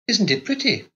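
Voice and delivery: put-on high, light young wife's voice; Carlotta's girlish voice. A narrator's reading. Narrator voicing the young wife to her husband, high and innocent. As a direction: lift into a childlike wife asking innocently.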